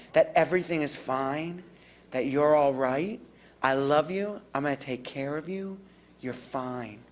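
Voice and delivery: calm a frightened person down softly; soothing reassurance. Shown as a demonstration. Caregiver reassuring an anxious older adult, soft and soothing. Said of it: "I'm gonna talk in a much quieter, slower way".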